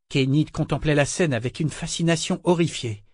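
plain reading voice

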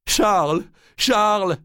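crier le nom, haut et fort; appel désespéré. Acted. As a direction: appeler son mari en détresse. Blanche appelle son mari, désespérée. (cri suppliant répété)

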